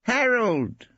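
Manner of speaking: high, firm correction; correcting Brittling. A narrator's reading. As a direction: insist on first names. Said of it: "he corrected her".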